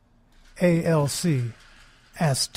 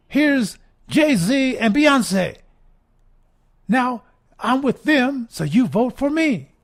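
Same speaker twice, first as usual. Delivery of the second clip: high, slow sing-song mock candidate voice; mock Hillary pitch; 7 semitones higher, 5 dB louder, slower than usual. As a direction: lay it on like a pandering politician. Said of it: "she's saying".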